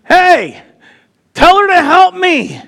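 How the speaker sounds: loud, high mock yell of protest; indignant complaint. Martha complaining to Jesus about her sister, indignant yell.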